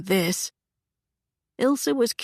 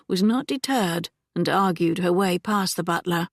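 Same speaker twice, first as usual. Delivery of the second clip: ordinary delivery, nothing marked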